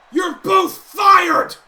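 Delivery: slow, very loud high bellow; furious firing. Acted. Gable firing both partners, slow furious bellow. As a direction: roar the verdict word by word. Boss fires the team.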